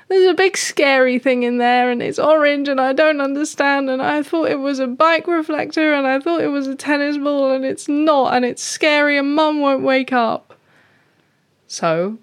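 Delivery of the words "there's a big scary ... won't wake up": high, breathless-run panicky kitty; panicking cat. Acted. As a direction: pour it all out in one high-pitched frightened rush. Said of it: "And Speckle goes".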